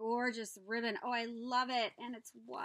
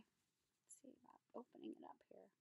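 much softer whispered mutter; whispered aside; 16 dB quieter than usual. Real, not acted. Opening the bag, whispered aside. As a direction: whisper to yourself while working.

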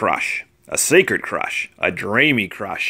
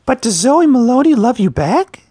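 put-on curious girl; 12 semitones higher, 4 dB louder than usual. A narrator's reading. Girls question Leon.

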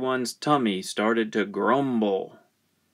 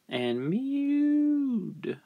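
high, soft mew on the word; high cat-like narration; 5 dB quieter than usual. A narrator's reading.

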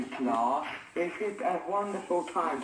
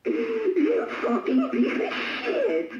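mock crying outburst; 13 semitones higher than usual. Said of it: "Oh, don't cry".

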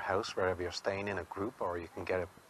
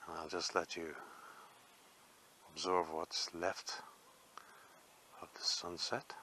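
softer, fading into a whisper; hushed; 3 semitones lower, 8 dB quieter than usual. Real, not acted. Vlogger to viewers watching the sunset, softening into a whisper. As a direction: drop to a whisper, let the sunset speak.

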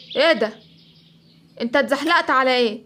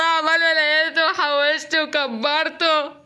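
ordinary delivery

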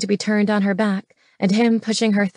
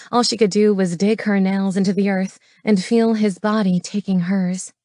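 plain reading voice

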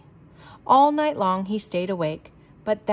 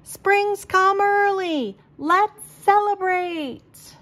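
high, slow, louder call across the hill; yelled; 11 semitones higher, 4 dB louder, much slower than usual. A narrator's reading. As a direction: announce it big from the hilltop. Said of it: "He opened his mouth and yelled".

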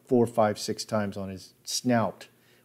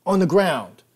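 much higher and louder yelled order; shouted echo; 9 semitones higher, 7 dB louder than usual. Retold or imitated. Hogg repeating the cop's order, shouted. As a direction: yell it again, harder. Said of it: "Hogg echoed".

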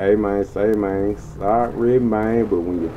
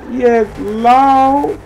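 much higher, louder hype call; higher excited cheer; 12 semitones higher, 6 dB louder than usual. Real, not acted. Showing off the car to viewers, higher hyped cheer. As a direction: push it up into a hyped shout.

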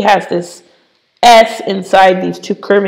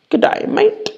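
koala puppet; 12 semitones higher, 4 dB quieter than usual. Pretend. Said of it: "my little friend, the koala".

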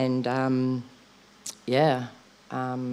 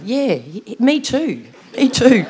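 put-on higher, laughing, eager kid voices, stammering me too; mock kids chiming in; 11 semitones higher, 9 dB louder than usual. Daughter mimicking her kids at the memorial, giggly higher voices. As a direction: imitate excited little kids piling in, giggling. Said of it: "them all saying".

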